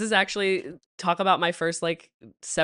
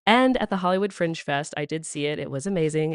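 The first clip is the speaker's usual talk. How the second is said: normal talking voice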